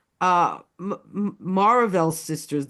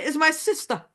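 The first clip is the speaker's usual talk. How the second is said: put-on Brooklyn accent; 9 semitones higher than usual. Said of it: "This is my, this is my".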